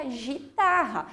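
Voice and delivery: guitarra said as jitarra, zh at the start; soft G like J. Shown as a demonstration. Teacher showing guitarra without the U, soft J sound. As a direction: say guitarra with g turned into j. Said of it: "se eu tiro o U, fica".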